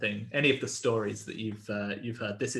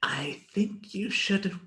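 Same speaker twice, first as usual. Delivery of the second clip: high, very slow, eerie little voice; creepy creature, stretched and high, whispered ending; small creepy voice; 9 semitones higher, much slower than usual. Acted. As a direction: draw it out eerily, fading into a whisper. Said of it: "quiet voice say".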